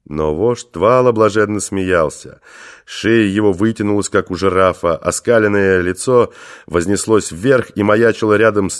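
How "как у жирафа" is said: ordinary delivery, nothing marked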